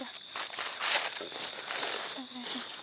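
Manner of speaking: slow hushed whisper; whispered prayer. Real, not acted. Frightened ghost hunter whispering at the spot, slow.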